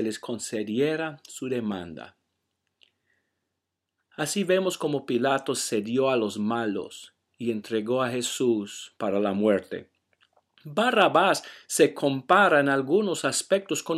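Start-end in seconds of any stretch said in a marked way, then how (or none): none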